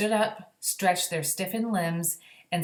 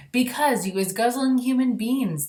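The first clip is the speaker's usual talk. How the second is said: higher, louder scolding BFG; 7 semitones higher, 5 dB louder than usual. A narrator's reading. The BFG scolding the giants below, raised and stern. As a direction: tell them off firmly as the BFG. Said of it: "the B F G answered".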